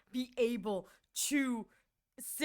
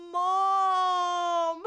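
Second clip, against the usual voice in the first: loud whining "Mom" with a mock cry; drawn-out pleading wail of "Mom"; fed-up daughter begging her mom; exasperated wail; 6 semitones higher, 7 dB louder than usual.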